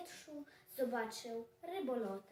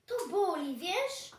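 louder, pained protest; acted hurt complaint; 4 semitones higher, 7 dB louder than usual. Punched cat to the dog, loud hurt protest. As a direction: complain loudly that it hurts.

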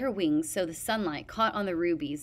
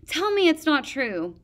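higher, louder desperate plea; pleading cry; 8 semitones higher, 7 dB louder than usual. A narrator's reading. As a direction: beg for it to be false. Peril pleading in despair.

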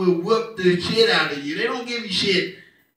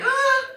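high hesitant uh. Retold or imitated. Son stalling to dad.

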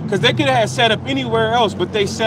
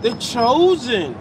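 very drawn-out mocking "chosen"; imitated mockery; much slower than usual. Scoffers taunting those called chosen, drawn-out mockery. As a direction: stretch it slowly as a mock title. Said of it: "It was a mockery".

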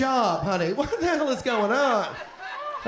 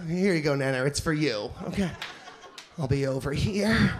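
soft, low, placating; 7 semitones lower, 3 dB quieter than usual. Retold or imitated. To confused Nana with the remote, low and placating. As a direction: talk low and gentle as to a child.

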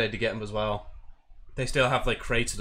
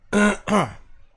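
throat clear; 10 semitones higher, 9 dB louder than usual. Real, not acted. Clearing throat before intro.

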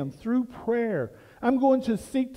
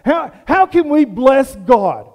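louder, earnest pleading question; disciples asking; 3 semitones higher, 9 dB louder than usual. Retold or imitated. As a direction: beg the master for an answer. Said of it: "The disciples asked that same question".

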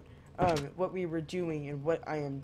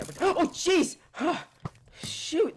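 higher, louder startled yelp; real fright; 12 semitones higher, 7 dB louder, slower than usual. Real, not acted. Gamer jolted by a surprise mob, real startled yelp. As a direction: jump at the sudden scare.

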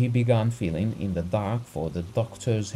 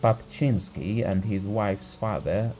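plain reading voice

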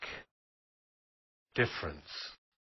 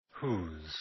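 ordinary delivery

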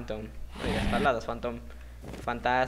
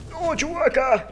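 anguished cry; 13 semitones higher, 5 dB louder, slower than usual. Real, not acted. Teammate dies.

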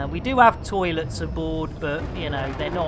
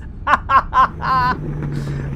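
high, loud burst of laughter; laughing; 8 semitones higher, 5 dB louder than usual. Real, not acted. Streamer amused mid-game, high loud laughing. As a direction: crack up in a high cackle.

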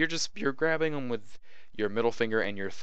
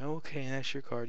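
timid, hesitant and higher; squeamish, wimpy; 5 semitones higher, 4 dB quieter than usual. Shown as a demonstration. Magician forcing a card, meek and unsure. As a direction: hand over the card like you're scared of it. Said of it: "a squeamish little wimpy attitude".

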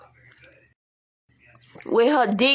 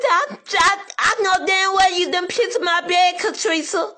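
mock angry roommate; 8 semitones higher than usual. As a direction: play the furious roommate, half-mocking. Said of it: "She said".

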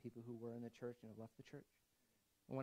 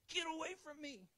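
much higher, mock toddler shoving you off; mock child pushing away. Kids pushing the parent away, much higher mock child voice. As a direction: play a small kid pushing a parent back.

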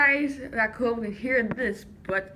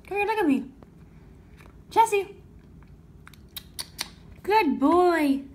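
praising pet voice; 5 semitones higher than usual. Real, not acted. Praising cat, high pet voice.

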